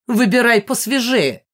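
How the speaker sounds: loud, mocking shout; shouted jibe. A narrator's reading. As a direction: call it out loudly as a taunt. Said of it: "крикнула мама".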